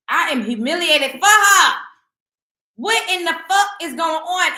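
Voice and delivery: high, loud outrage; outraged exclamation. Real, not acted. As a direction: vent the humiliation. Outraged at the post.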